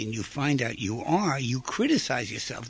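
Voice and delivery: usual speaking voice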